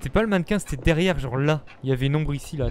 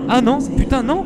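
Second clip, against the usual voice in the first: high panicked cry; 9 semitones higher, 7 dB louder than usual. Real, not acted. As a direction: panic as the light dies. Panic as light fails.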